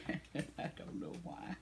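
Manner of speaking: crying whisper. Real, not acted. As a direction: let the tears take the voice. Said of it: "I can't keep from crying".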